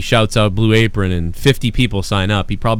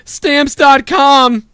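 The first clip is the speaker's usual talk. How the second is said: very high, slow, drawn-out; mocking ad read; 5 dB louder, much slower than usual. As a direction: stretch it out in a silly squeal. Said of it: "Bailey fucking goes".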